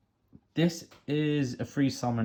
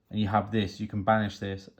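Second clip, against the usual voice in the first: ordinary talk, nothing marked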